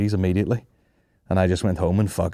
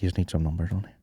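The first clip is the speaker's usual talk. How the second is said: a retold quiet remark, much softer; 3 semitones lower, 8 dB quieter, faster than usual. Driver meekly muttering about house numbers, much softer. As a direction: say it low and quiet, deflated. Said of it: "he just went very quietly".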